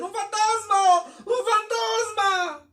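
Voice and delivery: high, loud, slow frightened cry; terrified cry. Retold or imitated. Disciples screaming at a ghost on the water, terrified cry. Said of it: "comenzaron a dar voces".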